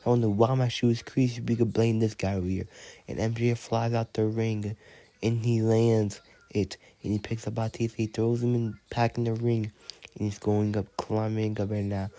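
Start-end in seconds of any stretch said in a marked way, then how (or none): 3.1-12.1 lively ringside play-by-play, rattled off; wrestling commentary. Acted. Announcer calling a toy wrestling match, lively. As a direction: call the action like a TV announcer.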